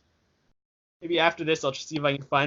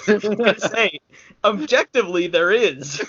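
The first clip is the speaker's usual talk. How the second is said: laughing; 5 semitones higher, 5 dB louder than usual. Real, not acted. Teasing cohost, laughing.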